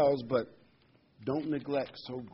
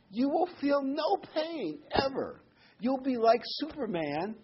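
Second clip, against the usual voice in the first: high, slow wonder; preacher thrilled at the hope; awed excitement; 12 semitones higher than usual. Real, not acted. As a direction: paint the promise vividly. Preacher marvelling at afterlife.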